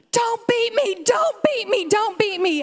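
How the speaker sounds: put-on very high, loud, wailing child's scream. Toddler on the store floor screaming at his parents. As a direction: shriek like a kid throwing a tantrum. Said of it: "started screaming".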